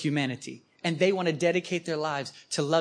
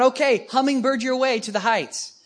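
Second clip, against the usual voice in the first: mock cheerleading; 7 semitones higher, 6 dB louder than usual. Mocking frantic flapping effort.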